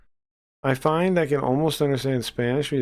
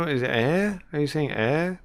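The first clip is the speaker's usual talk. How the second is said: ordinary talk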